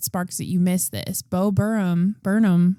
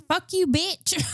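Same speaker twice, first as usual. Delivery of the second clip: put-on high bratty kid; 10 semitones higher, slower than usual. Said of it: "And then the kid's like".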